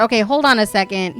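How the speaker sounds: normal talking voice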